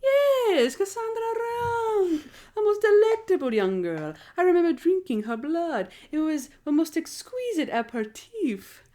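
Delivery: high, swooping theatrical vampire; vampire impression. Cafe vampire recalls drinking a woman's blood, vampire impression. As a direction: relish it like a hammy vampire. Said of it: "it's impression time".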